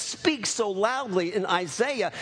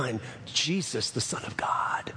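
low, soft voice sinking into a whisper; imitating a hushed, reverent voice of Jesus; hushed divine answer; 7 semitones lower, 5 dB quieter than usual. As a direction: answer gently and low, fading to breath.

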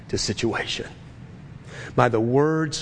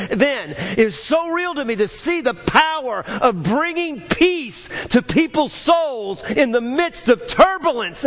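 full-force preaching; 9 semitones higher than usual. Acted. Pastor urging his congregation to bring peace, preaching at full force. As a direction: preach it out with rising fire.

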